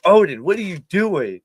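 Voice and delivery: calling out. Retold or imitated. Calling unresponsive dog.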